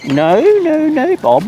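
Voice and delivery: high, playful no no no; mock scolding. Real, not acted. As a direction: tell the bird off in a squeaky voice. Scolding a bird fondly.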